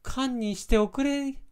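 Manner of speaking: Kyoto-ben, pitched well up; Kyoto woman's accent. Shown as a demonstration. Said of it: "女の人しか使わない".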